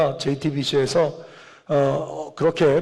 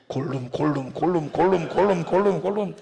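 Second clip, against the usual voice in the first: Gollum; 3 dB quieter than usual. Retold or imitated. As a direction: repeat the name like a throaty call, fading at the end. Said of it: "의성어로 바꾸면 뭔지 아세요". (골룸을 흉내 낸 반복 소리)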